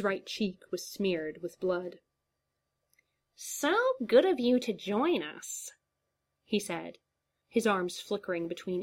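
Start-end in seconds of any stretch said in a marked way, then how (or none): 3.3-5.7 high, sneering villain voice, a little louder; the clown taunts with fake politeness; mocking clown. A narrator's reading. The clown greets his captive Eames, mock polite.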